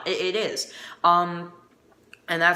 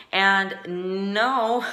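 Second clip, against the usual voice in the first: whispered aside; 3 semitones higher than usual. Real, not acted. Teasing twist in episode recap.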